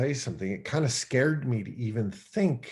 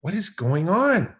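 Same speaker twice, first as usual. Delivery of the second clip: higher, louder self-scolding yell; man yelling at himself in his parked truck; 8 semitones higher, 3 dB louder than usual. Retold or imitated. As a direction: shout at yourself in the truck to snap out of it. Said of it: "I started yelling at myself".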